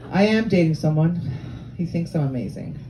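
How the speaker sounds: normal talking voice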